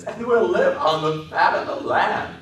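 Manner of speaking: high, loud, slow ecstatic delight; a child at the peak of joy; happy. A narrator's reading. Lenny giggling with happiness about their farm, high and loud. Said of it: "Lenny giggled with happiness".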